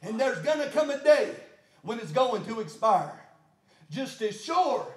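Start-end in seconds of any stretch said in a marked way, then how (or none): 3.9-4.9 rhythmic, gasping sermon rising to a long shout; full-force preaching. Real, not acted. Preacher pleading with the Lord for the remedy, full-force preaching. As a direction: build phrase by phrase to a high, loud plea.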